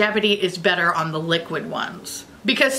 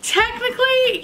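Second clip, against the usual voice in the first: higher, sing-song "Technically"; playful high; 14 semitones higher than usual. Real, not acted. Unsure how the game works, high and playful. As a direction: pop it up high and coy.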